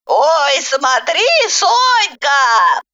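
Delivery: put-on high, warning old woman's voice; sighing snide granny. A narrator's reading. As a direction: caution with a knowing sigh. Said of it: "со вздохом сказала ехидная бабуля". (ехидная бабуля со вздохом)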